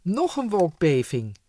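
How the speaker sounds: loud panicky shriek; frightened shriek. A narrator's reading. Frightened imp feeling the cloud shake, loud shriek. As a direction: cry out in fear of the quake. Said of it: "gilde een angstige imp".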